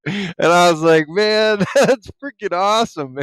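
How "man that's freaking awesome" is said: higher, excited, breaking into a laugh; delighted reaction. Retold or imitated. Retelling his reply in the chat, delighted and laughing. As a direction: gush with a laugh in the middle.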